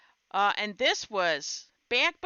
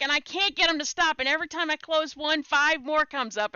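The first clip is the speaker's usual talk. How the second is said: higher, louder, rushed mock panic; imitated freaking out; 8 semitones higher, 6 dB louder than usual. Retelling husband's complaint about endless pop-ups, in mock panic. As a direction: blurt it fast, as a husband who is freaking out. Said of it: "he's freaking out".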